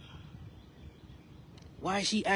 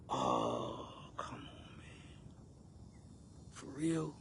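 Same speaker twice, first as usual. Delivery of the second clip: muttered disbelief; 14 dB quieter, much slower than usual. Retold or imitated. Exasperated disbelief.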